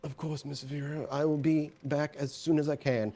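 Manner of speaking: put-on dutiful old man answering his mistress; old butler John. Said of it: "Do you mind retrieving it?".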